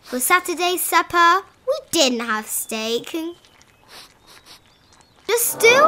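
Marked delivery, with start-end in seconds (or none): 5.3-5.8 high, loud disappointed question; dismayed gulp. Acted. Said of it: "gulped the Grickle".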